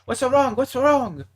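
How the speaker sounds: high, loud alarmed boss voice; startled manager. Retold or imitated. Night manager asking why he's yelling, high alarmed voice. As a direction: play the manager jumping at the noise.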